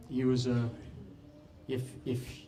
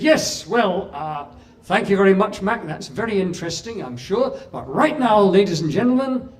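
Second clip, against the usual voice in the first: flustered chairman; 8 semitones higher, 10 dB louder than usual. Retold or imitated. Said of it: "And he said".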